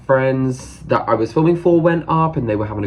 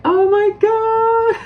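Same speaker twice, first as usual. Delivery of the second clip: very high, stretched-out squeal; imitated excited squeal; 4 dB louder, much slower than usual. Idol spotting her at the table, imitated excited squeal. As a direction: squeal with delight, drawing it out.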